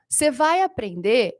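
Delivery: usual speaking voice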